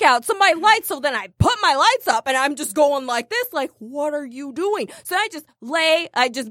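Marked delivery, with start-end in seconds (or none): 3.8-4.9 slow, softer, restrained question; exasperated disbelief. Retold or imitated. Driver flashing lights at a blocker, restrained exasperation. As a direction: say it slowly with held-back exasperation.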